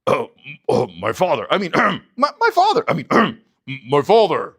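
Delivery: mock bad impersonation with throat clears; Jacob faking Esau. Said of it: "He's impersonating Esau".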